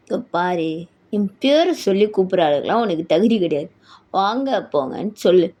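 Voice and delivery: low, imperious male voice; domineering husband. Acted. Husband forbidding wife to use his name, haughty.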